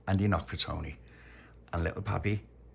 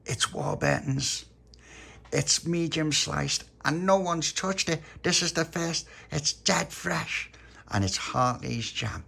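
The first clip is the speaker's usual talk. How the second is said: slow, raised, emphatic boasting; lightly voiced Tony, proud; 8 semitones higher, 3 dB louder, slower than usual. A narrator's reading. Tony offering baby Lucia a jam butty, slow and proud. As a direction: present each detail slowly and proudly.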